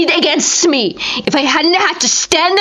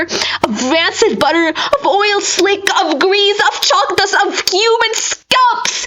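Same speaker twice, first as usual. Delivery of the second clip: rising panic; 7 semitones higher than usual. A narrator's reading. Escalating list of horrid smells.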